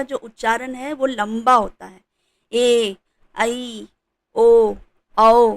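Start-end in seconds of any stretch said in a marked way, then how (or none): 2.5-5.6 ए, आई, ओ, औ each drawn out long and loud; long vowels. Shown as a demonstration. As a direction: stretch every vowel ए आई ओ औ. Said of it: "तो वो लंबा उच्चारण होता है". (लंबा उच्चारण)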